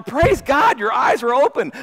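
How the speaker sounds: high, joyful exclamation; excited praise. Acted. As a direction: rejoice with him. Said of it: "with excitement".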